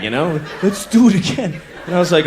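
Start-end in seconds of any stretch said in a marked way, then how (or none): 0.6-1.6 creepy. Retold or imitated. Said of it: "creepy like that".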